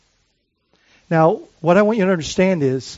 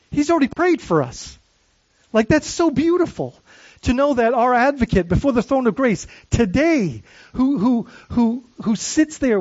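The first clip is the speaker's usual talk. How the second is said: rising fervour; 6 semitones higher than usual. Real, not acted. Preacher rising with emotion.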